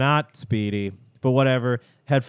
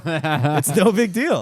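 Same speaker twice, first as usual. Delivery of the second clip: slow, drawn-out, mock nonchalant; mock-casual drawl; 4 semitones higher, 4 dB louder, slower than usual. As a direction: stretch it out like it's nothing. Drawn-out repeat for laughs.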